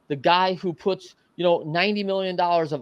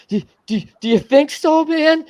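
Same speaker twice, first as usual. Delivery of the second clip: high, pleading and loud; begging for approval; 12 semitones higher, 7 dB louder than usual. Retold or imitated. Teen asking the major leaguer if he can make it, high pleading. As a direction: plead like a kid begging the big leaguer to say yes. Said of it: "begging for his approval".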